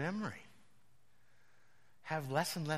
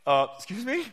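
higher, louder startled question; shocked; 7 semitones higher, 11 dB louder than usual. Retold or imitated. Pastor retelling his shock at the woman's words, higher and louder. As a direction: sound taken aback. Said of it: "I remember going".

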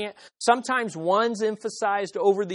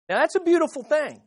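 lifted praise; 7 semitones higher, faster than usual. Shown as a demonstration. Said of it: "Let me say that again".

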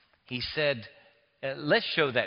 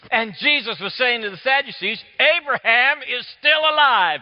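much higher and louder, proclaiming; fervent preaching; 12 semitones higher, 14 dB louder than usual. Acted. Preacher proclaims the living God to the congregation, fervent preaching. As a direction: proclaim it at full force.

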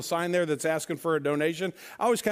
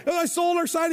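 high and a touch louder, pleased with himself; proud repeat; 11 semitones higher, 4 dB louder than usual. Retold or imitated. Sign holder tells his buddy again, high and proud. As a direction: say it again, beaming.

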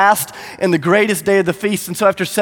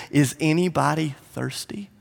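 soft, near-whispered question; quiet, tender appeal; hushed invitation; 7 dB quieter than usual. Acted. Jesus gently invites the crowd.

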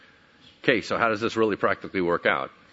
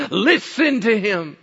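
slow, high, ringing command; God's commanding voice; 11 semitones higher, 6 dB louder, slower than usual. Retold or imitated. God commanding the disciples from the cloud, slow loud proclamation. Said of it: "a voice came out of the cloud saying".

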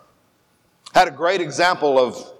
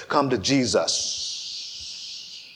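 very slow preacher drawing out his S's; drawn-out S preacher; 8 dB quieter, much slower than usual. Retold or imitated. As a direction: stretch every S like a revival preacher. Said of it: "a preacher who likes to draw out his S's".

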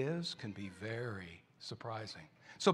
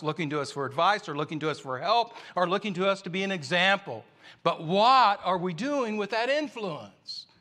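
raised, louder preaching push; preaching at full force; 6 semitones higher, 6 dB louder than usual. Acted. Preacher urging the congregation, raised and louder. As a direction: drive the point home to the congregation.

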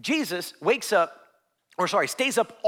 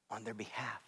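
whispered; 7 semitones lower, 17 dB quieter than usual. Acted. Preacher whispering about praying for others. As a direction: drop into a voiceless whisper.

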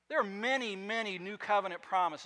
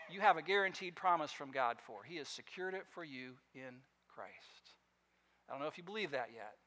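usual speaking voice

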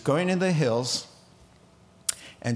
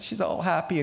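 normal talking voice

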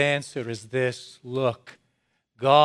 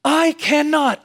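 preaching fervour, climbing higher and louder; getting excited; 8 semitones higher, 6 dB louder than usual. Real, not acted. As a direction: let the joy burst out, much higher and louder. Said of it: "I'm getting excited".

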